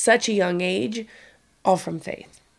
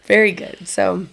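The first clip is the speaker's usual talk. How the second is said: ordinary talk, nothing marked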